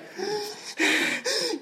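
high, drawn-out mock gasp; panicked gasp. Speaker shows how she hyperventilated at the hospital, panicked gasp. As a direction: imitate gasping for air. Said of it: "at the time I was like".

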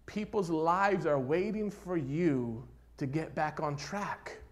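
ordinary talk

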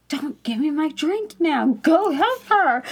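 retold urging with comic exasperation; exasperated. Customer telling the worker to serve another lady, mock exasperated.

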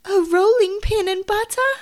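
high, loud, aghast question; alarmed exclamation. Acted. Tabitha hearing what the rat stole, loud alarm. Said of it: "exclaimed Tabitha".

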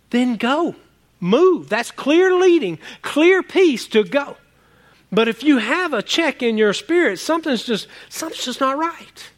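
rising pulpit fervour; fiery preaching. Acted. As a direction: drive it home like a revival preacher. Preaching peace and leading.

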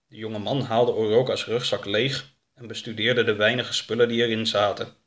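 usual speaking voice

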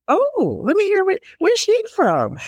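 high, curious mock wonder; intrigued surprise. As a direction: imitate a stranger suddenly curious, pitched high. Listeners startled by an accent.

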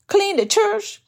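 incredulous, almost insulted repeat; mock outrage at a lowly chore; derogatory tone. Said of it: "Kind of a derogatory tone that I asked the Lord".

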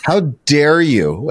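slow, drawn-out indignant retort; mock scolding. Woman in line chewing him out, slow mock outrage. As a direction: act out a woman chewing you out. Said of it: "chewed me out".

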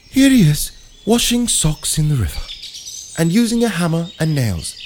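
high-pitched documentary narrator impression; David Attenborough. Narrator shows the orangutan at the river, documentary voice. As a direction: present the animal like a nature presenter. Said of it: "It was David Attenborough".

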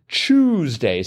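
"Tuesday" starting like the word choose; Tuesday as CHOOSE-day. Shown as a demonstration. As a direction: say "Tuesday" with a ch opening. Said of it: "spelled C H O O S E".

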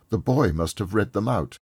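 ordinary delivery

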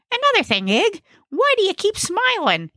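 very high, suspicious character voice; high-pitched comic accuser; suspicious Gnesi. Acted. Gnesi accuses Ig in the car, high suspicious voice.

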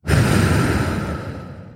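long, audible breath out; full exhale. Shown as a demonstration. Coach showing the breath between statements, a full exhale. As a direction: let all the breath go in one steady sigh. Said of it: "a full exhale".

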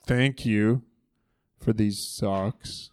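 put-on quiet, mumbled, sulky kid's thanks; mock sulky thank you. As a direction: drag out a reluctant thank you, eyes on the ground. Kid forced to thank, misty-eyed.

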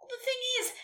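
high, put-on sad voice; mock reluctance, pitched high; trying to sound sad. A narrator's reading. Nelly pretending to refuse Santa, mock-sad. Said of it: "Nelly breathed, trying to sound sad".

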